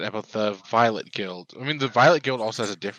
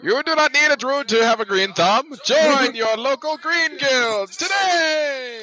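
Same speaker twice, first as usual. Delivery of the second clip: put-on old-timey ad read; 8 dB louder than usual. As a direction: sell it like a vintage radio ad. Reading out a fantasy ad poster.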